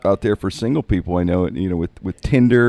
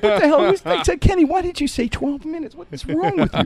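very high-pitched incredulous scolding; exasperated rant at a friend, played for laughs; retold exasperation. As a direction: squeal it in comic disbelief. Acting out his exasperation at Kenny.

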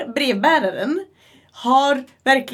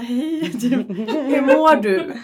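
imitating puzzled policemen; 2 semitones higher, 4 dB louder, much slower than usual. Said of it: "de hade en jättekonstig face".

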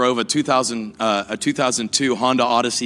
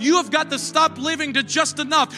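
higher, building sermon climax; preaching at full force; 10 semitones higher than usual. Acted. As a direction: preach it rising to the congregation.

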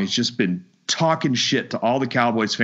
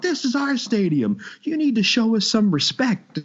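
mocking rival fan; 11 semitones higher than usual. Mocking the rival fan's demand.

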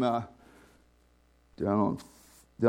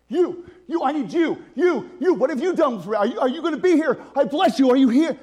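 raised, urgent pleading, louder and higher; intimate fervent prayer; 11 semitones higher, 6 dB louder than usual. Shown as a demonstration. Preacher shows the congregation how to pray, urgent and raised. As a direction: cry out to God as a close friend. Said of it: "Do you talk like this to God?".

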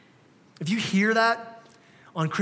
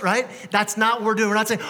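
normal talking voice

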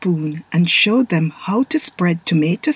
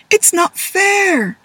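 high, slow whispered complaint; sulky kid pushing back; protest; 11 semitones higher, slower than usual. Acted. Taylor protesting to Dad, high and sulky. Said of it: "protested Taylor".

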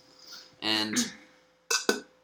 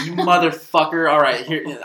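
mock dad yelling with a laugh; hammed-up angry dad; dad's pep talk; 6 semitones higher, 8 dB louder than usual. Son retelling dad's pep talk, loud mock yelling. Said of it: "really shitty pep talks".

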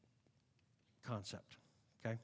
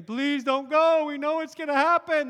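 pleading friends; 8 semitones higher, 8 dB louder than usual. Acted. Friends crying and begging Paul.

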